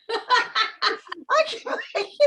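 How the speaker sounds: high, slow-motion wail through laughter; mock falling panic. Replaying her thoughts as she falls, mock panic.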